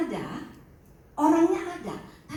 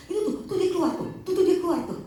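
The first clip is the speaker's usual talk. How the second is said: imitated panicked patient; 7 semitones higher, 4 dB louder, faster than usual. Patient sees figure leaving TV.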